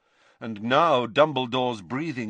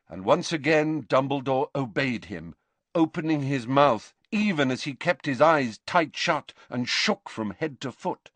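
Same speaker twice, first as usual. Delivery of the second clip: ordinary delivery, nothing marked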